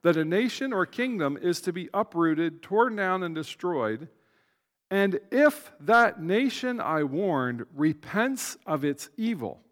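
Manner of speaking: measured, slowed reading of the text; formal Bible reading; scripture reading. Acted. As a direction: read it out with weight.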